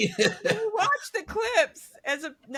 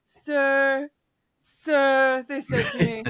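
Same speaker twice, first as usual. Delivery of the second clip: a hint of Trump's louder "Sir, sir"; mock Trump; 6 dB louder, much slower than usual. Mocking Trump telling his sir stories, lightly imitated. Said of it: "just him going".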